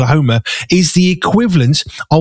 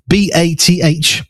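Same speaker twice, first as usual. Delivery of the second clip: ordinary talk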